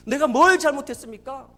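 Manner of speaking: retold wail of protest, loud and rising; crying out. Father in intensive care crying out to God, loud protest. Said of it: "그렇게 울부짖었대요".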